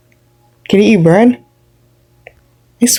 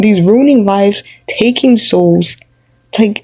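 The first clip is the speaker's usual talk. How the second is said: normal talking voice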